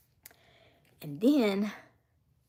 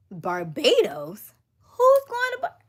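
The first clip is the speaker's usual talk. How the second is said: slow, then a much higher, louder cry; baffled shriek; 6 semitones higher, 7 dB louder, slower than usual. Retold or imitated. Hearing the cat's tip, squealing in disbelief.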